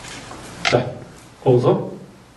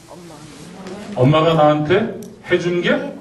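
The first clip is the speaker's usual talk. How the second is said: normal talking voice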